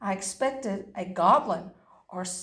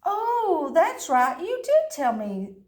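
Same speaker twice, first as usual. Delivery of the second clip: chipper dog; 5 semitones higher than usual. Acted.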